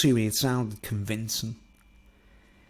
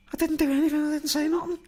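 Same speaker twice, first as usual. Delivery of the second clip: much higher, whiny mock protest; protesting friend; 13 semitones higher, much faster than usual. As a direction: squeak out an innocent denial like the friend. Friend voiced denying accusation.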